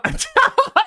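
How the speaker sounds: sudden high, loud laugh; burst of laughter. Real, not acted. Laughs at the finisher clip.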